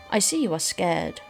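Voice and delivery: normal talking voice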